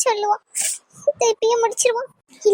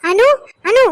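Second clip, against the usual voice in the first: calling out; 3 semitones higher, 7 dB louder, slower than usual. Acted. Calling out for Anu.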